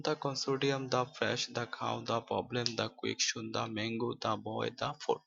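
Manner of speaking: "the" said "da" before every noun; "the" as thuh. Shown as a demonstration. Teacher listing consonant examples for learners, "the" pronounced "da". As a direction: say each "the" as "da", with no ee. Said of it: "তার পূর্বে দা উচ্চারণ হবে".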